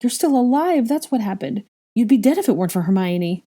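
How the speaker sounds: high, loud exasperated retort; heated, starting high; irritable snap. Acted. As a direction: snap at an ungrateful brother. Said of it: "Ginny snapped irritably".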